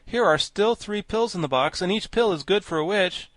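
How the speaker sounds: high, projected protest; animated storybook character; Scarecrow, insistent. A narrator's reading. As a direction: reason insistently with the party. Narrator voices the Scarecrow.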